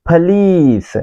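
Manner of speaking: पुलिस said pəlees, stress on the second syllable; American pronunciation. Shown as a demonstration. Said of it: "Americans". (American उच्चारण)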